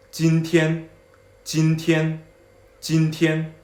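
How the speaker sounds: steady 今天, same length and strength; 今天 drilled evenly; even first tones. Shown as a demonstration. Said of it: "both first tones".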